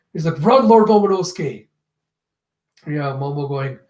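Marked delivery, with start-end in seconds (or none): 0.4-1.7 raised, higher acted call; urgent warning. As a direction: urge someone to flee, mock-desperate.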